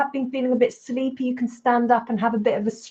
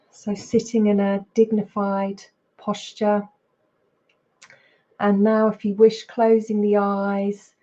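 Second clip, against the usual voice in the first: unhurried meditation guidance with long pauses; guided meditation. Real, not acted. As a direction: guide them into body and breath, leaving space between phrases. Leads a mindfulness practice.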